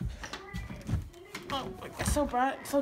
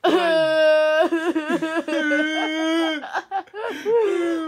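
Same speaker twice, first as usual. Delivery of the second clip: nervous laugh; 7 dB louder than usual. Acted. Tenant laughs while begging landlord.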